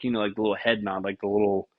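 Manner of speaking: ordinary talk, nothing marked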